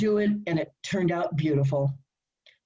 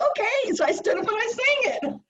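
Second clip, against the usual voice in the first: much higher, quick and bright with excitement; excited. Retold or imitated. Recalling singing for Barry on the spot, excited and high. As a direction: rush it out, thrilled at the memory. Retold being put on the spot.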